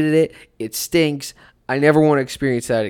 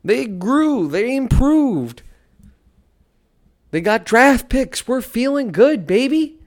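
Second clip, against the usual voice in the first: mock triumphant; 11 semitones higher, slower than usual. Sarcastic praise of losing team.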